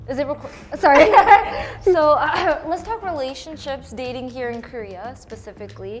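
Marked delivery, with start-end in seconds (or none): 1.1-1.8 sudden high burst of laughter; bright laughing burst; loud laugh. Real, not acted. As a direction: break into a loud embarrassed laugh. Embarrassed laugh mid-sentence.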